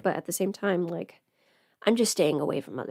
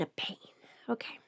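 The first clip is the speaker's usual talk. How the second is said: whisper; 14 dB quieter, faster than usual. Real, not acted. Whispering while doing lashes.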